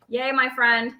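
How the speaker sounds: ordinary delivery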